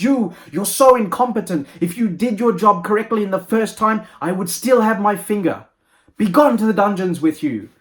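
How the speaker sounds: loud, high-pitched royal outburst; angry king. Acted. King blames the doctor for his lost finger, angry and loud. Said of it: "the king is so angry by this".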